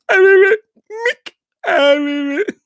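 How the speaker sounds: high, wailing boxer in mock grief; hammed-up sobbing, words stretched and slurred; Rocky impression. Grieving when Mick dies, hammed-up crying Rocky impression. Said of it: "when Mick dies. So he'd be like".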